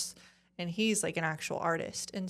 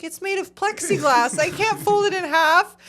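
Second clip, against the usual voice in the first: louder, higher, fed-up mock protest; exasperated retort; 9 semitones higher, 8 dB louder than usual. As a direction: replay your disbelief at the clerk, loud and high. Retold clash with post office.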